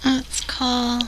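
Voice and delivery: usual speaking voice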